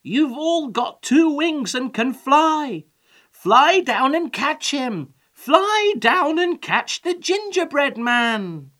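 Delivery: high, loud rallying cry; shouting. A narrator's reading. Old man sends the chickens down, shouting. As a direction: shout urgent orders, stretching the words. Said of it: "shouted the old man to the chickens".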